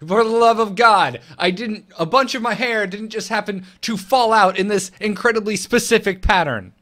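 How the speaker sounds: high, loud comic ranting; mock exasperation. Host voicing haircut wearer to nosy people, hammed-up exasperation. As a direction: act fed up with the obvious question.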